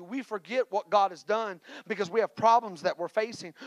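ordinary talk, nothing marked

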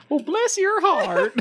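high, slow Southern belle drawl through a laugh; high Southern accent. Pretend. Teasing the expected alien voice, high Southern accent. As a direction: lay on the Southern charm, laughing. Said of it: "I expect a high Southern accent".

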